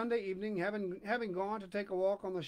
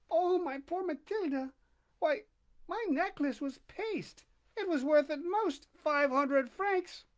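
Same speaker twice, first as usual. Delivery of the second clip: much higher, slow lady's voice, stricken; dismayed rich friend; 8 semitones higher, 4 dB louder, slower than usual. Acted. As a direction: break the news with pitying shock. Forestier reveals the fake necklace.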